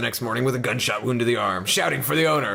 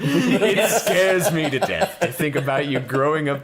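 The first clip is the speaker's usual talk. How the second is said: stern grocer; slower than usual. Acted. Grocer scolds the girl.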